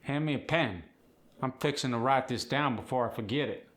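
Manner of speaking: Appalachian "pen" said as "pin"; Appalachian accent. Acted. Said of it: "short E becomes a short I".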